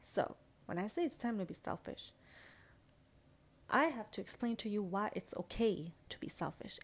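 usual speaking voice

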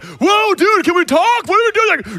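startled friend. Retold or imitated. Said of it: "And then the guy's like".

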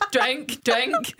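mock "drink, drink" through laughter; laughing imitation. Retelling a drive-thru worker's odd prompt through giggles. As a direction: repeat it while cracking up. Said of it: "I was ordering, laughing".

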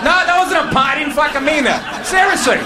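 high shouted denial; mock-indignant yell. Real, not acted. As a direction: shout the denial over the crowd. Defensive outburst.

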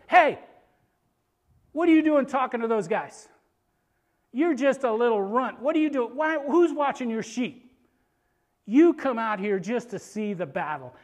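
loud, high, accusing telling-off; big-brother scolding. Acted. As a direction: berate a pesky little brother. Big brother berating little David.

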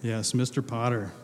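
ordinary talk, nothing marked

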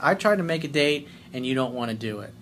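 ordinary talk

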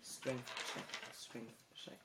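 talking through a mouthful of cake; mouth full. Real, not acted. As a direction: say it around a bite of cake. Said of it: "Talking with cake in my mouth".

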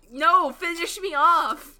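slow, loud dramatic plea. Retold or imitated. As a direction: beg like a broken heroine. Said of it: "she's just like".

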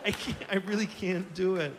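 mock-crying, broken laughing plea; hammed-up helpless whimper; smiling through tears. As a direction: give up with a tearful grin. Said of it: "like, smiling with tears".

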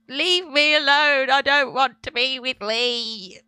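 little sister, teased by her brother, wailing back in tears; tearful child yell. Retold or imitated. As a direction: shout it through tears at a teasing brother. Crying kid yelling at brother.

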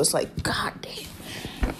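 slow whispered God damn. Real, not acted. Vlogger remembering the nurse, whispered slowly. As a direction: breathe it out in a tired whisper. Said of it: "whispered exasperation".

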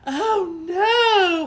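high loud wail of oh no; hollering. A narrator's reading. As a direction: Lucille cries out in dismay, very loud and high. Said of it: "hollered Lucille".

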